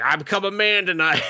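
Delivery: high put-on triumphant voice; hammed-up boast of manhood; mock proud hero. Hero boasting after the boob touch, in a high mock voice.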